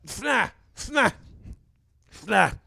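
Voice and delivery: sick employee fakes sniffles to his boss; mock sniffle noises. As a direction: play up a blocked nose for the boss. Sick man sniffling before boss.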